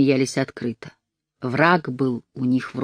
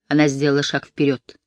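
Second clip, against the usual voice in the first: usual speaking voice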